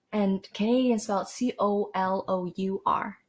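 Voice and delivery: ordinary talk, nothing marked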